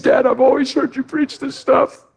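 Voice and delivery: high, lightly voiced son; son's voice. The son speaking to his dad, lightly voiced up high. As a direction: give the son's words up high. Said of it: "He said".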